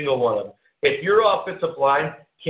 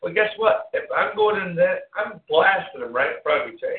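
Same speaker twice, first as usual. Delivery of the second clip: ordinary talk, nothing marked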